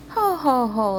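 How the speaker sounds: mock hearty ho ho ho; a pig laughing at his brother; teasing laugh. A narrator's reading. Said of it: "laughed the second little pig".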